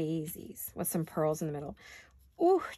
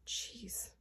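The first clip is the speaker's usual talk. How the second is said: whispered; 3 semitones higher, 12 dB quieter than usual. Real, not acted. Muttered to herself while crafting.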